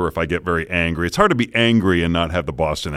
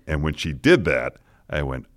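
ordinary talk

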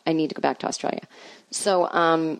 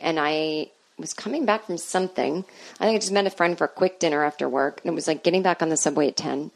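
ordinary talk, nothing marked

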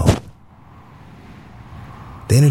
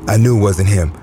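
usual speaking voice

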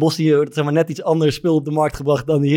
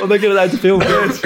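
laughing; 7 semitones higher than usual. Real, not acted. Laughing at movie cliché.

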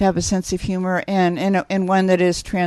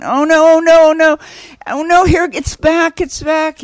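high, loud mock panic as a contraction hits; acting out a laboring mother's rising alarm, loud and high; panicked in labor; 8 semitones higher, 6 dB louder than usual. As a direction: cry out like the pain is coming back. Woman in labor as a contraction returns.

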